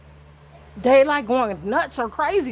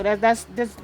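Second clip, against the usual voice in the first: ordinary delivery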